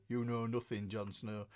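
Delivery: put-on low, quiet northern Game of Thrones accent. Host showing how northerners talk on Game of Thrones, low northern accent. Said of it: "they all talk like that".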